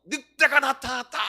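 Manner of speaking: high, loud cry; crying wolf again. Retold or imitated. Shepherd boy crying wolf again to the villagers, high and loud. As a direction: raise it up and call out the alarm. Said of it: "또 어떻게 하나요?".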